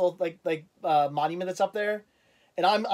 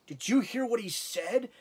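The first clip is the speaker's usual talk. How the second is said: slow, higher, incredulous question; incredulous onlooker; 4 semitones higher, 4 dB quieter, slower than usual. Retold or imitated. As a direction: imitate a stranger taken aback. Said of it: "I hear him go".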